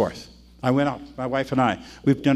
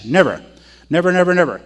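repeated "never" hit louder; emphatic never; 4 dB louder, faster than usual. Real, not acted. Preacher warns against buying lessons in sets. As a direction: hammer each never.

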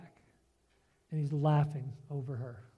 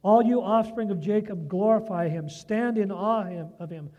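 preaching at full force; 7 dB louder than usual. Acted. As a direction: proclaim every verse like a sermon climax. Preaches psalm aloud at full force.

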